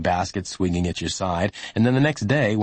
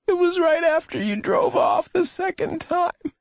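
put-on high, thin child voice; high-pitched and pained; weak hurt boy. A narrator's reading. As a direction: answer feebly as an injured boy. Injured boy answers policeman.

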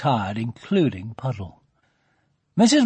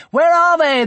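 excited; 9 dB louder than usual. Acted. Children eager for their presents.

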